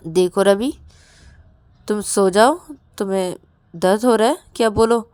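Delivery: loud, insistent concern; firm questioning. Acted. Rahul asks Ravi about pain, loud and firm. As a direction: raise your voice and make him answer.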